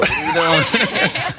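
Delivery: incredulous laughing shout. Retold or imitated. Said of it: "And I was like".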